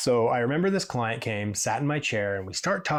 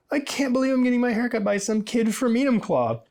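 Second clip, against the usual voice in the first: client's delighted disbelief; 11 semitones higher than usual. Retold or imitated.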